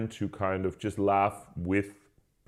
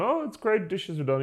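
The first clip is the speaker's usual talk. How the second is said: cheery flatmate; 13 semitones higher than usual. Retold or imitated. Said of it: "the guy coming downstairs going".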